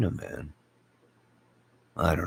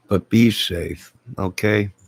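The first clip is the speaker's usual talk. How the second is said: louder and slowed, leaning on be; emphatic be safe; 3 semitones higher, 6 dB louder than usual. Real, not acted. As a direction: plant each word like a firm warning. Earnest farewell to viewers.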